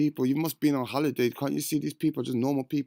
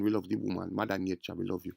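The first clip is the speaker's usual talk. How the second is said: hushed, lower tribute to women; softly; 4 semitones lower, 5 dB quieter than usual. Shown as a demonstration. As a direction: lower and much softer, tender.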